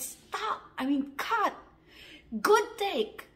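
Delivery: high, emphatic calls; director's call. Retold or imitated. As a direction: re-enact a director halting the scene. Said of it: "He came in and said".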